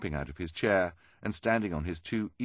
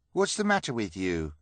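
a touch higher, demanding question; 4 semitones higher, 4 dB louder than usual. A narrator's reading. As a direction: press them for an answer. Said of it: "Hugo demanded".

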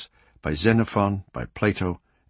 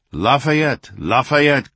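raised, higher narrated cry of a crowd; crowd's cheer; 5 semitones higher, 5 dB louder, much slower than usual. A narrator's reading. Paris crowds cheering Lafayette as he rides by, narrated cry. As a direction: call out the name like cheering crowds, louder and higher. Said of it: "cried the crowds".

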